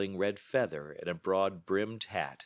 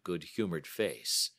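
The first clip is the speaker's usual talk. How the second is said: ordinary delivery, nothing marked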